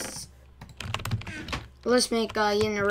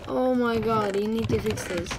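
ordinary talk

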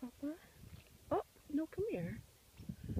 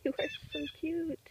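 1 cooing; 7 semitones higher than usual. Real, not acted. Cooing at squirrel.

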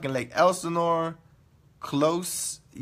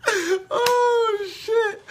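very high, squeaky after laughing; overcome by laughter. Real, not acted. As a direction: squeeze it out after a laughing fit.

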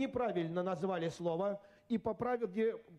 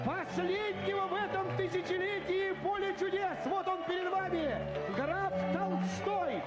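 high, slow, booming host announcement; game show announcer; 10 semitones higher, slower than usual. Acted. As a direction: proclaim the winner like a TV host.

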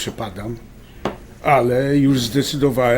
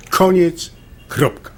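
firm final word; 6 semitones higher, 5 dB louder, slower than usual. Acted. Husband cuts off the argument.

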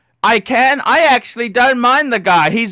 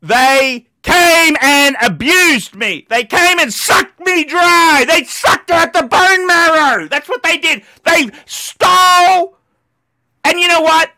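furious rant; 6 semitones higher than usual. Real, not acted. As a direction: spit out every word in real rage. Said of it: "I am so angry at the moment".

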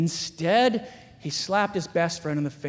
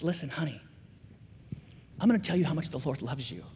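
tender father; 3 semitones lower, 6 dB quieter, faster than usual. Retold or imitated. Father tenderly telling daughter.

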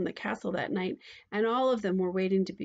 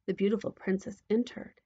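ordinary delivery

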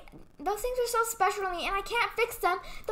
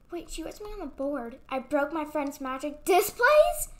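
ordinary talk, nothing marked

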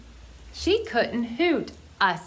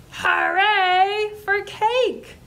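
excited cheer; 9 semitones higher, 3 dB louder, slower than usual. Acted. Cheering for cake to kids.

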